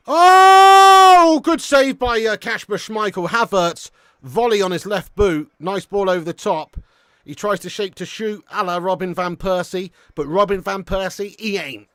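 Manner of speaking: loud, high burst on the save, easing gradually; excited commentary. Real, not acted. Streamer calls a good save by Schmeichel, excited commentary. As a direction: call a near miss with real excitement. Live reaction to a near goal.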